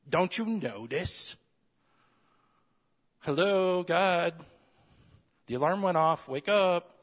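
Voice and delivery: hammed-up complaint, yelling for God to wake up; mock demanding shout. Demanding believer shouts at God to wake up, mock yell.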